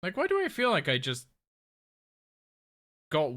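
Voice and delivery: surprised. Real, not acted. Baffled by sudden weakness in game.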